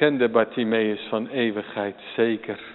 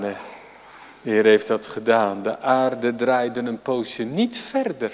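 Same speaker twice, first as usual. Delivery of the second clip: ordinary talk, nothing marked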